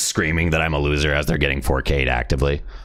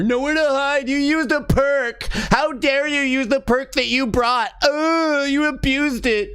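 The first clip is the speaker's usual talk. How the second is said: much higher, slow whiny wail; ridiculing the crying survivors; mock crybaby whine; slower than usual. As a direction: drag out the complaint like a sobbing child. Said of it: "People cry their fucking tits off over".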